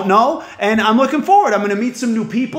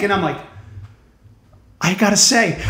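ordinary delivery

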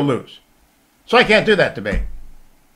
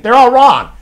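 emphatic declaration; 10 semitones higher, 10 dB louder than usual. Real, not acted. Host rebutting legal scholars.

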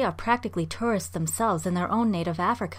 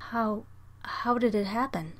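hesitant question; 3 semitones higher, 3 dB quieter than usual. A narrator's reading. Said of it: "bewildered by these strange creatures".

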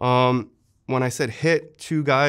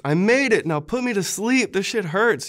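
retold scream at surgeons; 8 semitones higher than usual. Wounded soldier yelling to surgeons to put him under, high strained yell. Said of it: "I remember screaming to the surgeons".

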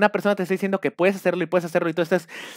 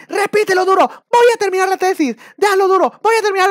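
mock coach yelling; 14 semitones higher, 8 dB louder than usual. Coach yelling at a student to break him down, mock shout. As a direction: bark insults like a cult coach.

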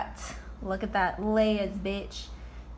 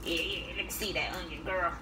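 mock flirty voice; 6 semitones lower, 10 dB quieter than usual. Flirting with the onion.

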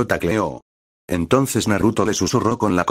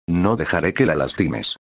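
usual speaking voice

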